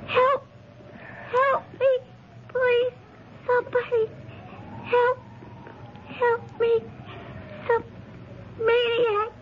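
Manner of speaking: high, faltering calls for help; weak cries for help. Acted. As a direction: call out for help, fading and hurt. Wounded victim calling for help.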